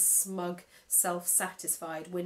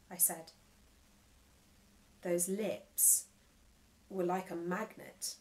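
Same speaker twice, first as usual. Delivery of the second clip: plain reading voice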